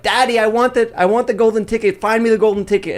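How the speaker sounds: high-pitched mock spoiled kid; demanding rich daughter. Rich girl begging her factory-owner father, high demanding mock child voice. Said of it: "and she's like".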